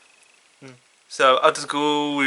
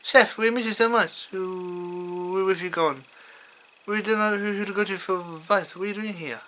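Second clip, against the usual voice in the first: put-on higher, soft plaintive kid voice; Kyle; 4 semitones higher, 5 dB quieter than usual. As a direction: plead softly in a raised pitch. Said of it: "like, Carl's like".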